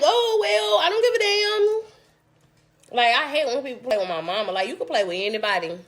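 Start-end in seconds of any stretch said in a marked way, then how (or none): 0.0-3.0 high, slow, drawn-out sarcastic brush-off; dismissive. Retold or imitated. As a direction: stretch it out, mocking and unbothered. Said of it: "And I'm like".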